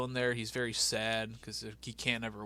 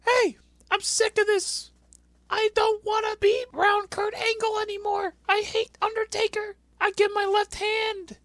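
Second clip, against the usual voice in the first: screaming rant; 8 dB louder than usual. Retold or imitated. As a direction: scream it out like a furious heel. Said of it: "Daivari just starts yelling".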